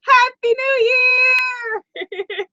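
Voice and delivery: high, drawn-out whoop; celebratory cheer. Real, not acted. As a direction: cheer in the new year, stretching it out high. Cheering in the new year.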